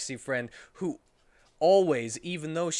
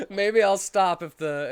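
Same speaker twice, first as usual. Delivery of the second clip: laughing; 7 semitones higher, 9 dB louder than usual. Real, not acted. Laughing at viewers.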